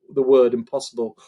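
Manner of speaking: ordinary delivery, nothing marked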